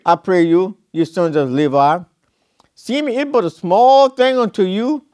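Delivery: solemn, measured Bible reading; scripture reading. Acted. Preacher reading Numbers to the church, solemn scripture voice. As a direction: read it out like holy writ.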